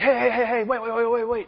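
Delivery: high, rapid-fire repeated calls to stop; imitating an alarmed friend; urgent halting. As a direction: stop people as they head out. Friend halts them at the door.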